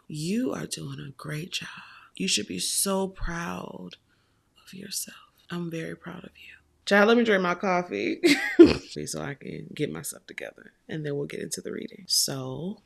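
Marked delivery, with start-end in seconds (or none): 0.1-6.5 soft reassuring whisper; tender hushed praise; whispered encouragement. Real, not acted. Encouraging the viewer, "here's me saying it. 8.3-9.0 laughs. Real, not acted. Laughing at herself over coffee.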